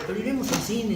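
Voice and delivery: high, loud chirpy invite; mock cheerful friend voice; friend's invitation. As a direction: sell the cinema plan brightly. Friend voiced in matchmaking story.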